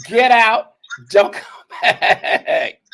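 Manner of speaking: loud, high get out. Acted. Kicking careless workers out of the store, loud yell. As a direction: order them out with a yell. Telling bad workers to leave.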